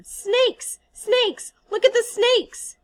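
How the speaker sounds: put-on high, child-like terrified scream; screaming little boy. Little boy screaming about snakes at the boys, terrified. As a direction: shriek like a frightened small child. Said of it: "The little boy screamed at them".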